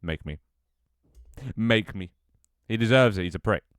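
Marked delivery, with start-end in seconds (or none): 1.6-2.1 defiant. Real, not acted. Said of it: "Do not diss [name]".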